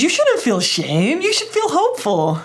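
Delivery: the fixer insisting, pitch soaring at the end; pushy fixer. Acted. The fixer part correcting the perfectionist, high and insistent. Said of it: "And then the fixer says".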